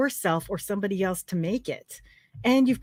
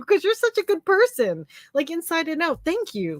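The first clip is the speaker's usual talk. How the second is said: high-pitched, real tearful warmth; emotional, voice lifted high with tears; tearful praise; 9 semitones higher than usual. Real, not acted. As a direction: praise a friend while welling up. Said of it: "I'm crying".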